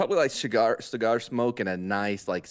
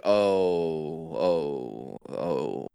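retold reaction; 6 semitones lower, much slower than usual. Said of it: "No, I was like".

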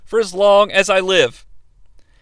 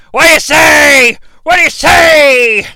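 high, very loud repeated yell; hammed-up shouted demand; imitated referee shouting; 7 semitones higher, 9 dB louder than usual. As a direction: bellow it into a wrestler's face, drawing it out.

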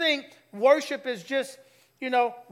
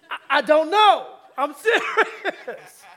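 louder, laughing through the words; laughing confession; 4 semitones higher, 9 dB louder than usual. Real, not acted. Pastor laughing at his own sweet tea habit. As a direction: admit it while cracking up.

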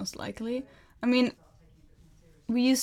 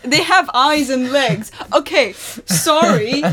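laughing; 3 semitones higher, 11 dB louder, much slower than usual. Real, not acted. Joking protest about fruit.

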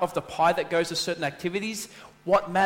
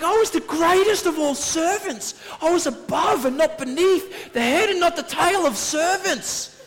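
mock indignant rant; 10 semitones higher, 4 dB louder than usual. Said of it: "I was like".

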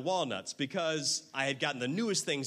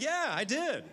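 proud laughing answer; 10 semitones higher than usual. Retold or imitated. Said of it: "And I was like".

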